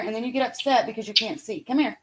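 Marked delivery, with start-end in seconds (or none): none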